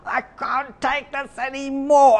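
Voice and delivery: drawn-out, high cry of desperation without volume; retold scream. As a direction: stretch it out like a strained mock scream. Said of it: "I scream to God".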